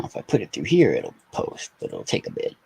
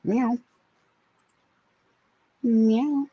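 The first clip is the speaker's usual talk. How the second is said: much higher questioning meows; meowing at cat; 12 semitones higher than usual. Pretend. Owner calls her cat with much higher rising meows. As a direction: meow up at the end to call her.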